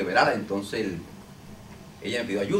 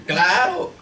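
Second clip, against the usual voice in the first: demon's answer; 10 semitones higher, 12 dB louder than usual. Retold or imitated.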